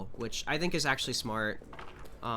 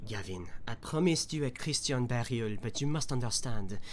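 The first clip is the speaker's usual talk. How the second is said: reading a note in a light French accent; a lightly done French accent reading aloud. Shown as a demonstration. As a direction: read the letter like a Frenchman, th turned to z. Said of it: "let me read this in my French voice".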